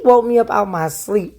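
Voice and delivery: usual speaking voice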